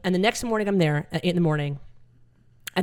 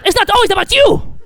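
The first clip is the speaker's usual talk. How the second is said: much higher and louder, laughing through it; mock mom squeal; 10 dB louder than usual. Comic voicing stoned mom scolding dad, high laughing squeal. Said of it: "she's like".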